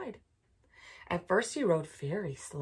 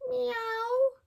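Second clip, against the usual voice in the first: high cat meow; 4 dB louder than usual. Acted. Cat voiced in story.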